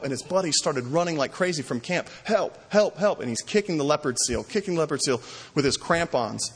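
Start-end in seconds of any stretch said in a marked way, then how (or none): 2.2-3.2 mock yelling help, pitched up; retold yell for help. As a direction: cry out help as the friends come running. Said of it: "just to start yelling, crying out".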